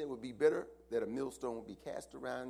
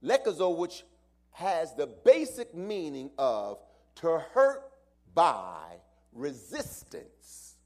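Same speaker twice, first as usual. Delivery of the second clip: higher, louder, slow preaching; preaching at full force; 8 semitones higher, 14 dB louder, slower than usual. Acted. Pastor explaining the Greek word to the church, loud preaching. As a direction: lean into each word and build.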